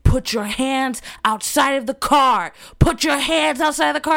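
loud commanding order; cop shout. Retold or imitated. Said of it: "He's like".

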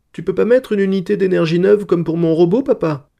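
put-on high, loud child's voice; little girl. A narrator's reading. Little daughter asking papa to fix the stars, high child's voice. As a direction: plead it as a small daughter.

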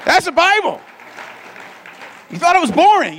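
high, loud whooping; rally the room; excited cheer. Real, not acted. Pastor cheering the crowd after the reading, loud.

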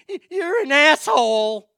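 loud, high-pitched tearful wail; mock crying yell. Crying woman walking out on a customer, hammed-up wailing yell. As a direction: imitate her blurting it out in a sobbing shriek. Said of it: "she's crying".

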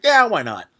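easy-going tipsy yes; drunk friend agreeing. Retold or imitated. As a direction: toss it off like a tipsy friend up for anything. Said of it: "And he was like".

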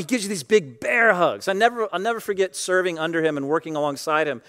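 usual speaking voice

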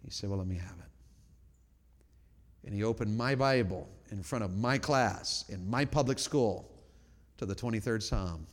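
grieving softly. Real, not acted. Preacher recalling his friend's suicide, low and hushed. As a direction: let the grief pull the voice down low.

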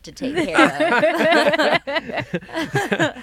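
laughing while talking; words squeezed through laughter. Real, not acted.

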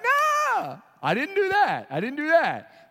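high, loud insistent no; loud protest of innocence; emphatic denial. Real, not acted. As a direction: deny it loudly.

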